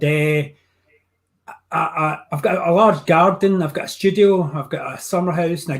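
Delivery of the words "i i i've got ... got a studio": normal talking voice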